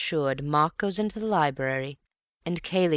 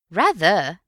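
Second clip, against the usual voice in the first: eager; 3 semitones higher, 7 dB louder than usual. A narrator's reading. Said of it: "He got up eagerly".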